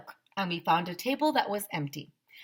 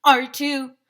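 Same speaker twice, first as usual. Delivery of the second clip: mock taunt; 8 semitones higher, 10 dB louder than usual. Kids chant back at each other.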